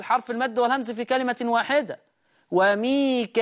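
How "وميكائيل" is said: وميكائيل with long held aa, then hamza; extended madd and kasra hamza in وميكائيل; Warsh reading. Shown as a demonstration. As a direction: stretch وميكائيل before the glottal -il. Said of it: "هيصير مد متصل".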